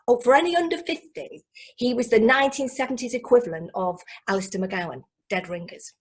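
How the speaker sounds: normal talking voice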